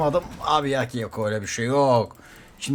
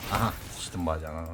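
low muttered catchphrase; 7 semitones lower, 7 dB quieter than usual. Acted.

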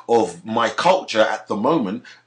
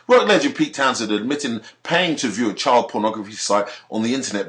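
usual speaking voice

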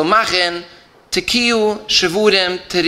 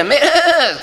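imitating a crying child's high whine; mock child whining; 10 semitones higher than usual. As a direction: whine like a kid who wants ice cream. Child whining for ice cream.

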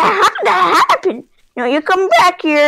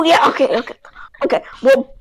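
ordinary talk

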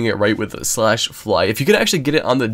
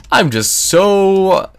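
drawn out exasperation; 9 semitones higher, 5 dB louder, much slower than usual. Real, not acted. Exasperated at terrible loot.

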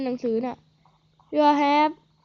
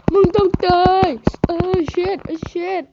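stretched, theatrical battle cry; hammed-up drawn threat; 5 semitones higher, 7 dB louder, much slower than usual. Real, not acted. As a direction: drag "มึงต้องตาย" out slowly in a higher, louder, strained voice. Repeats threat mid-fight.

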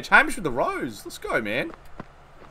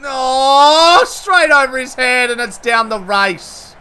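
loud groaning no, then slower; anguished call while watching the ball; dismayed cry; 8 semitones higher, 10 dB louder, slower than usual. Real, not acted. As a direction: wail as the ball flies away. Ball sails for six.

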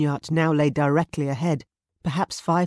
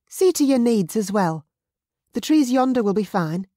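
bossy Mary; 7 semitones higher, 6 dB louder than usual. Acted. Mary instructing James.

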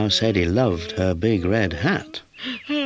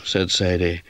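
plain reading voice

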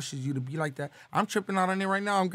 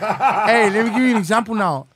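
loud, laughing, higher and giddy; excited laughing announcement; 4 semitones higher, 12 dB louder than usual. Real, not acted. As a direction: blurt it out through laughter. High, laughing after getting weed.

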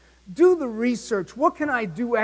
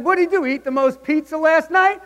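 much higher, louder joking quip; joking dad; 7 semitones higher, 4 dB louder than usual. Retold or imitated. Dad jokes with Mr Kirby, higher and louder quip. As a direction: brush it off with a grin. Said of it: "I said".

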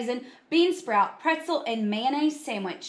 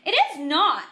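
higher, loud denial; indignant protest; 7 semitones higher, 7 dB louder than usual. Acted. Carla denying Buster's joke, indignant protest. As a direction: protest firmly against the teasing.

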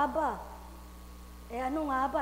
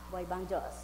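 quiet; 4 semitones lower, 6 dB quieter, faster than usual. Acted. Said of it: "Ay, ba't teka sumisigaw siya?".

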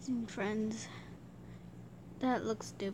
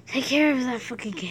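ordinary talk

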